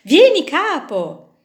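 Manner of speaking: high, loud, slow call; inviting call. Acted. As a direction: call the boss to come along. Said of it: "dissero rivolgendosi alla vite".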